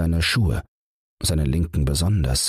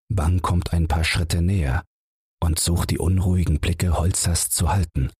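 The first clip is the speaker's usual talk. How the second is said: ordinary delivery, nothing marked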